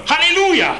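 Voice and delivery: high, loud cry of joy instead of a pain yell; a joyful shout in place of an ouch; shouted hallelujah. Retold or imitated. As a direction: burst out with a jubilant Halleluja. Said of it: "rief er".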